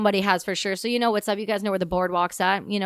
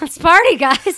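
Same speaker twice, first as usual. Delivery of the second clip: higher and much louder, a whooping mock shout; hyped party shout; 7 semitones higher, 10 dB louder, slower than usual. Veteran replays her arrival line for the host, a full hyped shout. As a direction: shout it up to the rafters for the crew. Repeats it even bigger.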